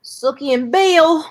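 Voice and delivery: loud, slow Southern drawl; mock Southern accent. Host mimicking True Blood after hating the accent, loud Southern drawl. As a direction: stretch it out in an exaggerated Southern accent.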